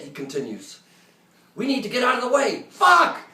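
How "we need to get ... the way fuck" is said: high, loud and panicked, peaking on the last word; panicked scream. Retold or imitated. Helo pilot screaming to get out of the way. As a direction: yell it as the missile flies past. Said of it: "screams the helo pilot".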